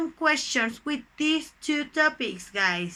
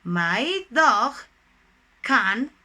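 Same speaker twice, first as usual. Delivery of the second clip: isolated words with long gaps; word-by-word dictation; 3 dB louder, much slower than usual. Shown as a demonstration. Teacher gives dog examples of can, word-by-word dictation. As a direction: set each word down separately, firmly.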